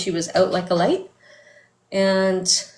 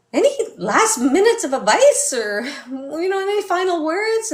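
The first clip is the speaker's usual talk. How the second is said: mock eager question; 10 semitones higher, 4 dB louder than usual. Family pestering mom for last words.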